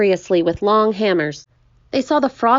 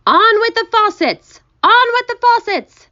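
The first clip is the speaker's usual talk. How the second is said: loud high-pitched shout; high, ringing yell; shouted command; 6 dB louder than usual. A narrator's reading. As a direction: shout it out like a far-reaching order. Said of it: "it shouted".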